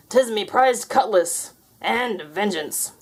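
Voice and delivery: slow, menacing pirate ghost; drawn-out pirate threat; slow pirate menace. Acted.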